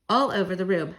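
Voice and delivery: usual speaking voice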